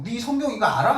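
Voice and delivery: 높은 음으로 비아냥대듯 "네 성경 이거 알아?"; taunting. Retold or imitated. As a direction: needle them with a smug jab. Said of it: "하면서 막 깐죽거려요". (약 올리듯 깐죽거리며)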